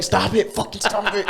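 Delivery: high-pitched mock yell; exasperated high yelp, acted out; retold yelling. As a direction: re-enact frantic yelling at the dog. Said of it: "bro, yelling".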